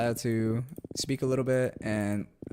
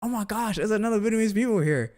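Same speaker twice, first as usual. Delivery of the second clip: much higher, louder, gushing mock auntie voice; excited Vietnamese aunties; 11 semitones higher, 5 dB louder than usual. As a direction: squeal like a thrilled auntie meeting a compatriot. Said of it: "they're like".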